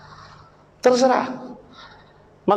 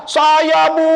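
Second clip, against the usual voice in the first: put-on high, louder eager children answering; eager kids shouting; 8 semitones higher, 4 dB louder than usual. Preacher mimics schoolkids shouting to teacher, high and eager.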